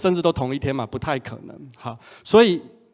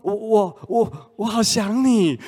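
put-on higher, slow, stammering girlfriend voice; shy stammering longing; 7 semitones higher, slower than usual. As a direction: stumble over the words, aching with longing. (结结巴巴、高声的想念)

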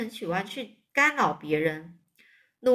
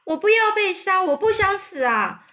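high, loud cries of fear; terrified wail; 8 semitones higher, 6 dB louder than usual. A narrator's reading. Wilbur pacing the barn, wailing loudly in fear. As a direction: howl that you don't want to die. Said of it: "韦伯就尖叫说".

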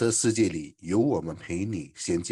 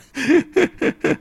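high giggle; 5 dB louder than usual. Real, not acted. Amused at time passing.

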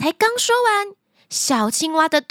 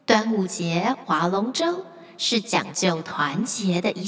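lake spirit; 5 semitones lower, 4 dB quieter than usual. Acted. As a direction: speak gently as the lake spirit. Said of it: "传来湖之精灵的声音".

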